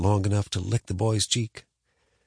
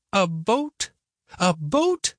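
put-on higher, disbelieving old woman; incredulous grandma; 10 semitones higher, much slower than usual. A narrator's reading. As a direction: repeat it in disbelief.

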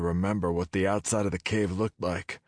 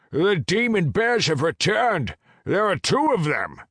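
alarmed dragon shout; 13 semitones higher, 6 dB louder than usual. Acted. Dragon alarm at demon bears.

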